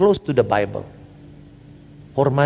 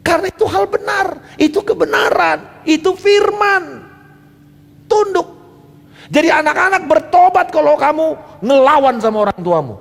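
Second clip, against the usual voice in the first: fiery preaching; 10 semitones higher than usual. Acted. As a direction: thunder it from the pulpit. Preaching at full force.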